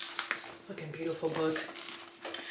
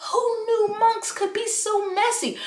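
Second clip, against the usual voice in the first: much higher, louder, slowed gleeful exclaim; excited squeal; 7 dB louder than usual. Real, not acted. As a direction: squeal it with gossipy delight. Delighted outrage about book drama.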